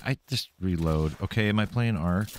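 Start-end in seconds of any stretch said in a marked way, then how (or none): none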